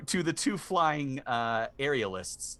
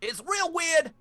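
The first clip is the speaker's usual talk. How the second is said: high parrot voice; squawky bird warning; 10 semitones higher than usual. Acted. Parrot explains traps.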